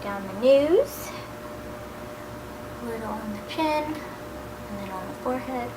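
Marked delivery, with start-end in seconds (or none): none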